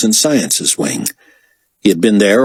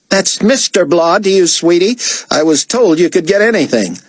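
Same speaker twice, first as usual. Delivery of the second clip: high, haughty diva voice; sassy artiste, offended; 10 semitones higher than usual. A narrator's reading. Artiste correcting David's form of address, offended and sassy. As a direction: put him down in a high, fussy voice.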